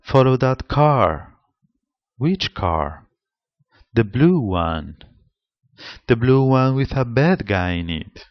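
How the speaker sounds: little dialogue with stress on new information; acted dialogue. Shown as a demonstration. Teacher acts out a car chase exchange, stressing new information. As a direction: read out the car chase lines, punching what is new. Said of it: "this example from Gilbert".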